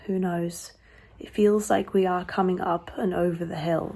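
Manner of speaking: usual speaking voice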